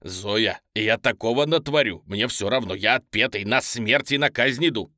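громкий напористый крик; грозить через дверь во весь голос; отчаянная угроза. A narrator's reading. Продолжает кричать в запертую дверь. (исступлённо и громко)

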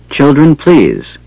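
understated stern command. A narrator's reading. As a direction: draw the words out with mild authority. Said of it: "her voice stern and commanding".

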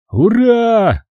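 high joyful hurrah; cheering. A narrator's reading. Soldiers cheering planes, high cheer. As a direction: cheer with relief. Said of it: "закричали мы".

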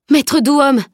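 high, loud cry of a name; sudden exclamation. A narrator's reading. Girl suddenly spotting her missing teacher, crying out loud and high. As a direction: call out in sudden alarm. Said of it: "s'exclama soudain Camille".

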